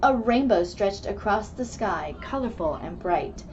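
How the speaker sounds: plain reading voice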